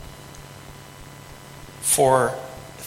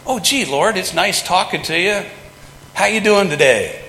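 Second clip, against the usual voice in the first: mock chummy greeting; 6 semitones higher, 3 dB louder than usual. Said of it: "Jeremiah didn't say".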